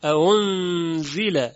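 اَأُنزِلَ slow, second hamza softened without a w; correct tashil. Shown as a demonstration. Teacher corrects the error, slow softened recitation. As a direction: draw out اَأُنزِلَ, gliding from a into u.

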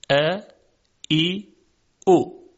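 ا ای او said the Arabic way, clear and open; Arabic short vowels. Shown as a demonstration. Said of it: "اما در زبان عربی قدری تفاوت داره".

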